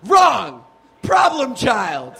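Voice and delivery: loud, high buzzer-like verdict; shouted quizmaster rejection. Acted. Comic rejecting audience's action comedy guess, loud shout.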